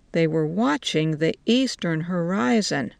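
usual speaking voice

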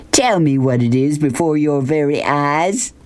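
proud showman's crow. A narrator's reading. Beauchamp shows off the tiger to Rob, lightly crowing. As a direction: present it like a prize. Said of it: "he crowed".

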